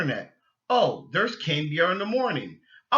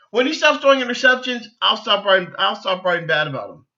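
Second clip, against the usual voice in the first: higher, louder, fast jabbing comeback; mock reporter retort; 5 semitones higher, 8 dB louder than usual. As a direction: throw the journalist's line back, fast and loud. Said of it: "Tim kept saying".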